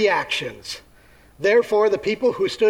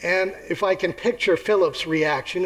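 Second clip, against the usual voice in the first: ordinary talk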